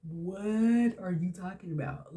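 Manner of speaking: slow, drawn-out disbelief; retold incredulous retort. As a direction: stretch out a baffled "what". Her stunned reply on the phone.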